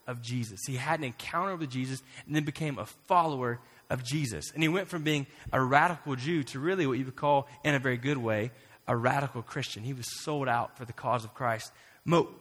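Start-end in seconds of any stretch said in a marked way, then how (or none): none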